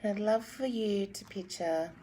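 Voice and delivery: calm meditation guide; guided meditation. Acted. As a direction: guide them gently into breathing.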